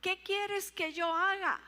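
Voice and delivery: high, loud, slow plea to the Lord; pleading cry. Acted. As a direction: cry out, surrendering. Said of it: "Pero primero dice". (clamor suplicante, agudo y lento)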